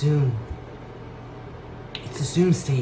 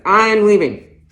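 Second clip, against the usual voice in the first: louder, higher declaration; huffy exit; 7 semitones higher, 9 dB louder than usual. Acted. Shopper storming out past the clerk, loud. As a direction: announce your exit in a huff.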